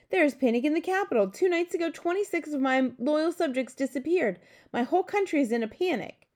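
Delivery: high, agitated, rushed at first; panicked. A narrator's reading. King reporting missing subjects to the Queen, panicked. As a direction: pour it out in high alarm.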